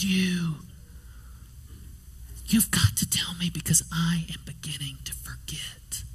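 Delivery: put-on hushed, secretive child's whisper. Four-year-old alone bending over baby sister, whispering earnestly. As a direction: whisper it with long pauses, as if to a baby.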